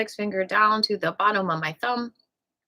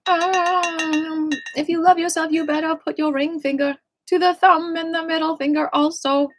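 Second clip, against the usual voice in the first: playful sing-song; 8 semitones higher, 3 dB louder than usual. Acted. Sings [name] line as instruction.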